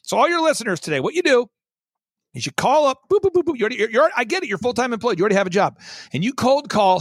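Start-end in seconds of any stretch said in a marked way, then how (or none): 3.1-3.6 high, quick phone-keypad boops; high-pitched dialing noises; imitated dialing beeps.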